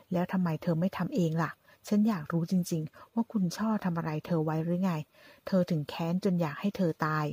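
plain reading voice

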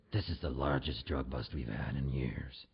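low, slow federale voice; mock tough cop. Federale tells his passenger about the bust, low mock-cop voice.

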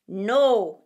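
low, loud refusing weasel; stern weasel. A narrator's reading. Weasel refusing the bat's plea, low and loud. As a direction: flatly deny the bat.

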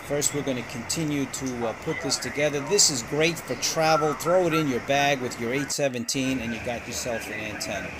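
normal talking voice

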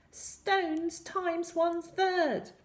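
high, slow squeaky incantation; sly spell-caster. A narrator's reading. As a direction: cast the spell slowly in a squeaky pitch. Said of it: "He slyly pointed his stick".

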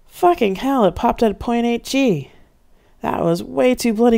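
shaken yell after a close call; high, slow, urgent outburst. A narrator's reading. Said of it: "Nigel yelled".

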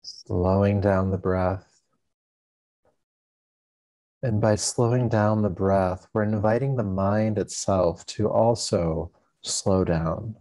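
calm, unhurried meditation guide with long pauses; guided meditation. Acted. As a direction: ease listeners into stillness, leaving space between phrases.

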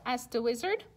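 ordinary delivery

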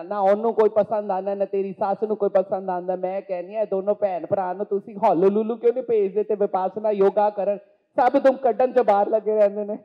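loud, fast Punjabi mom on the phone; Pammi auntie voice. Acted. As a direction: chatter away to your daughter, scolding. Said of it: "why can't you enact that for us".

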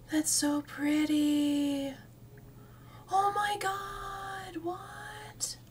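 breathless awe. Real, not acted. Gasps at pretty ivy in game.

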